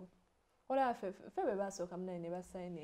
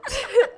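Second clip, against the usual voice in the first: sudden high loud laugh through tears; emotional laugh; 17 dB louder than usual. Real, not acted. Mid-confession to her partner, a teary burst of laughter.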